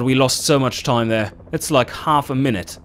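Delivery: ordinary delivery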